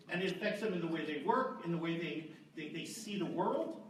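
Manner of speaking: usual speaking voice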